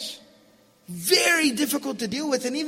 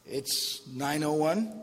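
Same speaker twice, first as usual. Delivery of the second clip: slow, drawn-out annoyed answer; annoyed son; 6 semitones lower, 6 dB quieter, much slower than usual. Acted. Son answering his father's repeat question, slow and annoyed. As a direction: drag out the time like you're fed up.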